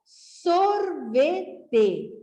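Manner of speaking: sorvete drawn out slowly; teacher slowly joining the syllables; slow blended word. Shown as a demonstration. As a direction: stretch sorvete out as one word. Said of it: "Para juntar".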